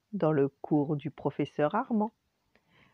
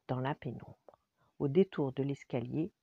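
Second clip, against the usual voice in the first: usual speaking voice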